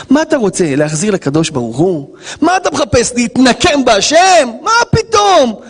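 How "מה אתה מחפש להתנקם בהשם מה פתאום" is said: high, rising indignant outburst; indignant rebuke. Acted. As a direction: challenge them in disbelief, pitch climbing. Preacher rebukes seeking revenge on God.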